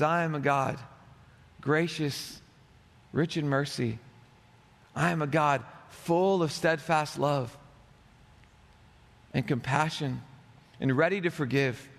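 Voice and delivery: low, calm voice of God. Acted. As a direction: speak as God, gently assuring. God's self-description, voiced.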